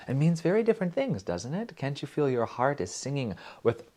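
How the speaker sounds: ordinary talk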